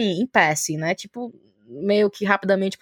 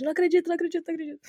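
happy disbelief; 7 semitones higher, 5 dB quieter, much faster than usual. Retold or imitated. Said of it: "gritando, assim, de felicidade".

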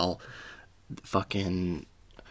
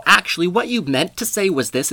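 know-it-all correction; 9 semitones higher, 5 dB louder than usual. Retold or imitated. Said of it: "corrects your grammar and they go".

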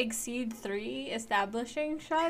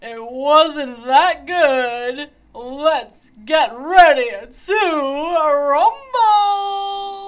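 booming, slow announcer voice; 3 semitones higher, 12 dB louder, much slower than usual. Acted. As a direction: draw it out like a ring announcer. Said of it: "Very, very well done, [name]".

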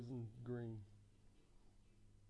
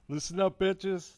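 loud, higher playful shout; outdoor voice; 7 semitones higher, 16 dB louder, slower than usual. Pretend. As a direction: call it out to the whole room. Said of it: "outdoor voice".

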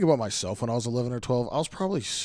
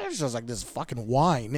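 ordinary talk, nothing marked

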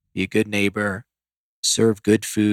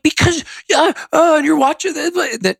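high-pitched, spluttering mock yell; raised voice; 3 dB louder than usual. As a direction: sputter in protest, pitch way up. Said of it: "Why are you raising your voice?".

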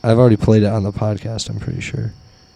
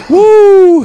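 whoop; 8 dB louder than usual. Real, not acted. Laughing at the story.

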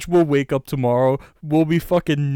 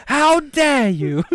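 slow, higher, louder Greta impression; mock Greta outrage; 11 semitones higher, 4 dB louder, slower than usual. Mocking Greta in the made-up news story, slow and shrill. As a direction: stretch the outrage out slowly.

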